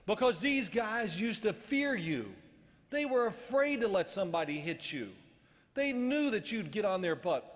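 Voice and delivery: high, loud, slow retold scolding; coach chewing out. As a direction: hammer each phrase like an irate coach. Acted coach chewing him out.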